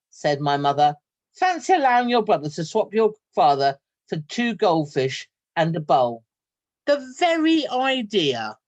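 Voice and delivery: lively read-aloud storytelling voice; story narration. A narrator's reading. Teacher reading a story to her class, lively. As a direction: tell it like a picture book to children.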